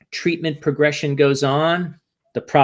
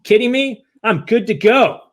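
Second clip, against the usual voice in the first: high, loud, cocky fake confidence; brash show of being fine, pitched up and loud; mock bravado; 8 semitones higher, 3 dB louder than usual. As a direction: pump it up like nothing can touch you. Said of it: "what we showed on the exterior facade".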